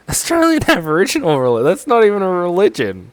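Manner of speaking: loud, high laughing outburst of disbelief. Real, not acted. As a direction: laugh it out in shocked disbelief, fast.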